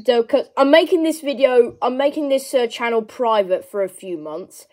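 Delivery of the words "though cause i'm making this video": normal talking voice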